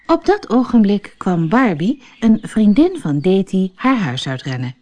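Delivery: ordinary delivery, nothing marked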